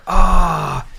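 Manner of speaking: 3 yell it as the bell cuts you off; 1 frustrated outburst. Retold or imitated.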